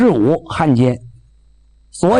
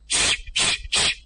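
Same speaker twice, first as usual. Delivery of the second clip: pump hissing; 9 dB quieter than usual. Retold or imitated. Pumping air, empty pumps.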